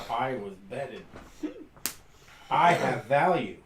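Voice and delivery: puffed-up, self-important character voice; proud scarecrow. Acted. Game master as scarecrow boasting of being betted, proudly. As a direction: declare your own worth with pride. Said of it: "In a proud voice".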